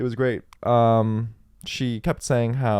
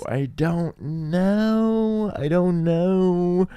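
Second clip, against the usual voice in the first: slow, drawn-out and unsure; drawn-out uncertain; 6 semitones higher, much slower than usual. Real, not acted. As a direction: stretch it out, torn. Unsure about moving back.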